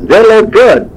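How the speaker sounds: high-pitched waitress voice. Retold or imitated. Voicing the waitress.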